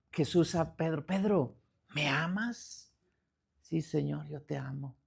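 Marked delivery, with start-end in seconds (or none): 1.1-2.9 soft, slow, tender question; imitating a tender Jesus, hushed; compassionate. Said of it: "con compasión". 3.6-5.0 hushed, lower answer; acted soft confession of love; humble reply. Peter humbly answers Jesus.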